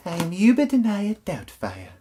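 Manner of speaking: high-pitched movie character impression; Missus Doubtfire. Reviewer quoting the film he just named, high put-on character voice. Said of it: "Missus Doubtfire".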